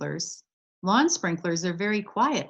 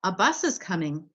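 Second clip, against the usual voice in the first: ordinary delivery, nothing marked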